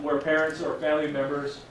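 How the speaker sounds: usual speaking voice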